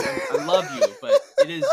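high, loud, squeaky laughing voice; real laughter pushing the voice way up; breaking into laughter. Real, not acted. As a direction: lose it laughing as you say it. Breaking up laughing while conceding.

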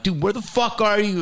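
retold outburst of exasperation; losing it. Comic retells confronting his late writing partner, exasperated. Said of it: "lost my mind".

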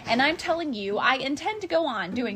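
loud angry yell. Acted. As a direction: yell it out angrily. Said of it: "I yelled at the Lorax".

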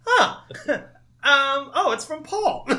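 high, laughing surprise; mock surprise. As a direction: discover the gift, delighted. Acting surprised at fountain.

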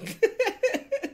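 very high burst of laughter. Real, not acted. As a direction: squeal with laughter.

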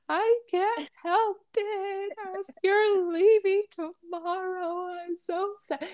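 high, drawn-out tearful wail; exaggerated crybaby whine, very high; mock crying. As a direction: wail it slowly, hammed-up sobbing. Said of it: "I started crying".

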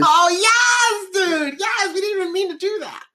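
high shouted gleeful voice; mock British gloating. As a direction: crow like a smug Brit. Acted British reply.

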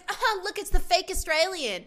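high-pitched mock jeer; imitated scream. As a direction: screech it like a taunting mean girl, hammed up. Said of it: "She just screams out".

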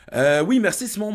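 light up for the donor; cheery shout-out; excited thanks. Real, not acted.

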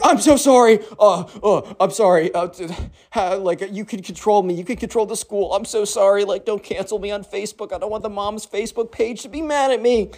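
high, stammering, whiny grovelling; mock panicky teacher. Teacher begging the girl not to cancel them, mock panicky. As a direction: beg in a hammed-up timid voice.

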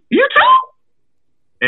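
very high, loud squeak of mock astonishment; astonished disbelief. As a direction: re-enact amazement in a squeaky falsetto. Retold astonished reaction to friend.

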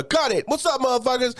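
high hype-man call; hype MC shout. Retold or imitated. Retelling Brit's stage bit, high hype call.

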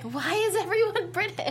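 high, loud mock exasperation; voicing a viewer's loud disbelief; incredulous viewer. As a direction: ask it like a baffled, exasperated viewer. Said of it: "And you're like".

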